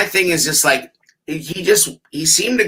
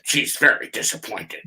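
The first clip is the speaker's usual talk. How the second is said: whispered nerdy voice; hushed mock stat-guy read; 3 semitones lower, 7 dB quieter than usual. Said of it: "the stat voice".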